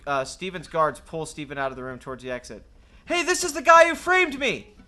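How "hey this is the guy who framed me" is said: loud, high protest; shouting. Acted. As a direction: shout it as you're dragged off. Shouting while dragged out.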